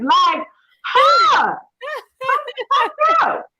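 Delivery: mock outrage. Real, not acted. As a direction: call her out for making her cry. Holding back tears, joking.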